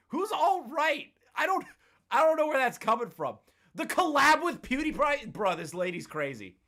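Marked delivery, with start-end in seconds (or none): 0.1-5.3 high, raised, incredulous questioning; baffled disbelief. Real, not acted. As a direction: voice shooting up in bewilderment. Baffled by the accusation.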